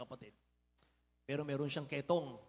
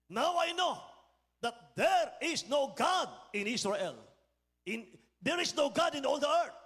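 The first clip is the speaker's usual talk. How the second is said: high, loud triumphant declaration; general's proclamation; 7 semitones higher, 4 dB louder, slower than usual. Retold or imitated. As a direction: cry it out like the healed general. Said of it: "at siya'y nagsabi". (malakas at mataas na pagpapahayag)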